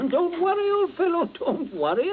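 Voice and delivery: high, hearty laugh through the words; laughing reassurance. Acted. Princey seeing the captain out, laughing. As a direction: laugh it off over his worry.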